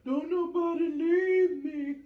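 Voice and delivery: put-on loud, high, drawn-out toddler wail; mock screaming cry. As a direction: wail slowly like an abandoned three-year-old, hammed-up.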